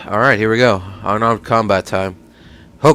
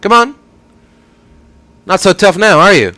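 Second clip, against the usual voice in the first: high, loud jeering; loud taunt; 7 semitones higher, 6 dB louder than usual. Acted. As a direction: shout the taunt, high and cocky. Taunting a beaten opponent in the fight.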